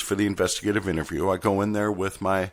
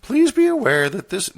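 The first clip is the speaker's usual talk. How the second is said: squeaky-high, louder put-on voice; high, loud put-on supervisor voice; high-pitched voice; 13 semitones higher, 6 dB louder than usual. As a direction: attempt a high-pitched character voice, pushed loud. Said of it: "I'll do a high-pitched accent".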